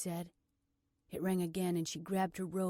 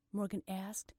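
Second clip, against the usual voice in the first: plain reading voice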